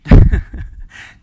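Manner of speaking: loud laugh breaking out; loud burst of laughter. Real, not acted. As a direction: laugh hard at the joke.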